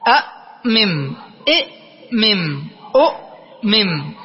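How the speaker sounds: slow أأمم، أؤمم in separated syllables, each ending mim; split-up word forms. Shown as a demonstration. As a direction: sound out أأمم، أؤمم slowly, pausing after each hamza. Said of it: "فنقول".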